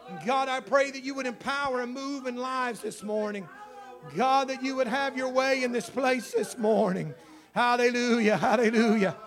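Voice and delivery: loud, high, impassioned praise; fervent prayer. Real, not acted. Pastor praying aloud for his church, impassioned. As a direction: cry out to God before the congregation.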